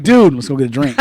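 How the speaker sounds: loud casual dude bro voice. Retold or imitated. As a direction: talk like you do to your boys. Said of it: "I'm not gonna say it to my homeboy".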